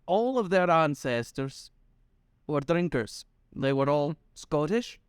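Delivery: normal talking voice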